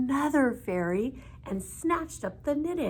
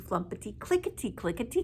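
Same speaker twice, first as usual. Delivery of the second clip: plain reading voice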